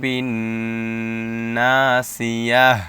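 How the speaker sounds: slow, loud chanted tajweed reading; Quran recitation. Shown as a demonstration. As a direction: draw the word out melodically. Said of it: "teacher recites the finished word". (ধীরে টেনে সুরেলা তিলাওয়াত)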